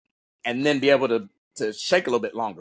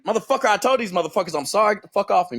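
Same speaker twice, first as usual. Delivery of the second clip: high, rapid-fire mock tirade; retold angry outburst; 7 semitones higher, faster than usual. Delirious trainee cursing the man grabbing him, retold angry outburst. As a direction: rattle it off indignantly, pitched up. Acting out his delirious row.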